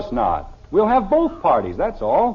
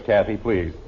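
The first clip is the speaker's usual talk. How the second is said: ordinary talk, nothing marked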